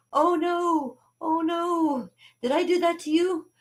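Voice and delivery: high-pitched, hammed-up horror; scared little kid. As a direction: plead like a child who fears they hurt someone. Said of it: "I was so scared".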